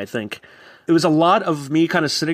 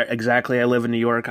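ordinary talk

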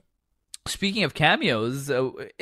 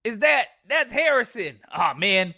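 mock Larry; 8 semitones higher than usual. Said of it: "he's like".

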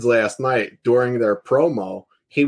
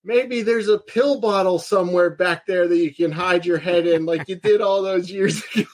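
higher, sneering wrestling promo; mocking promo taunt; 7 semitones higher than usual. Son on the mic mocking his father, higher and sneering. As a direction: taunt him cruelly on the mic. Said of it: "And he, he goes".

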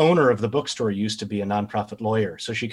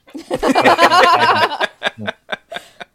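loud, high burst of laughter; big laugh; 10 dB louder than usual. Real, not acted. Laughs after lawyer joke.

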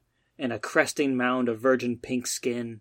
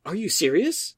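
higher, eager question; barely contained excitement; 6 semitones higher than usual. Acted. Matt thrilled that Stacy loves forty K, barely contained excitement. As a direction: hold back your thrill, just barely. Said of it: "barely unable to contain his excitement".

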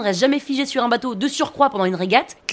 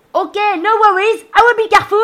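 boat-style shouting; 10 semitones higher, 9 dB louder than usual. Acted. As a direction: shout it across the deck in English. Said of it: "je leur dis façon bateau".

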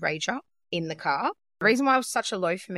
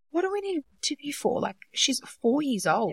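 higher-pitched incredulous questioning, lightly acted; mildly mock a baffled husband, pitched up; imitated puzzled husband; 7 semitones higher than usual. Husband questions an interview for a four-year-old, imitated bafflement. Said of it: "my husband's like".

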